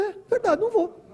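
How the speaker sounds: high, bright-voiced mock reply; relieved agreement. Chico agreeing with Emanuel, high and relieved. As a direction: answer high as if suddenly convinced.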